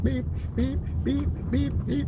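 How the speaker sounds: very high, soft electronic beeps; short high-pitched beeps, softly voiced; imitated test beeps. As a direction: mimic the tones of a hearing test. Imitates school hearing test tones.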